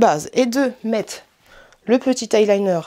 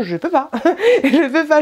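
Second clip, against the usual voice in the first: laughing; 6 semitones higher, 4 dB louder than usual. Real, not acted. Laughs at her own failure.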